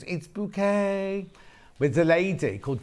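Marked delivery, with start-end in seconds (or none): 0.0-1.3 slow, drawn-out posh lady's Bouquet; mock posh Bouquet. Quoting the sitcom lady who insists on Bouquet, slow mock posh. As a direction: stretch out Bouquet like a snooty lady. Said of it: "She goes".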